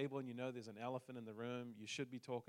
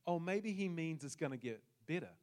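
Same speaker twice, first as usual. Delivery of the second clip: excited; 4 semitones higher, 8 dB louder than usual. Retold or imitated. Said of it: "started to think through my brain".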